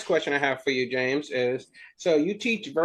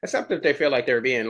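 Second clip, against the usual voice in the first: normal talking voice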